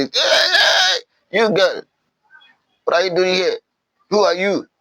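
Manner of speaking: high, sharp-edged rebel challenge; hostile challenge. Acted. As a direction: call out an intruder, pitched well above your normal voice. Rebels confront the girl in story.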